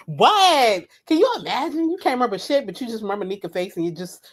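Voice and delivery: normal talking voice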